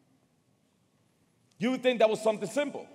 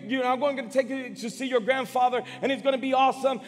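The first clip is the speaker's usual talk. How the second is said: excited dad; 6 semitones higher, 4 dB louder than usual. Retold or imitated. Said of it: "My dad was excited".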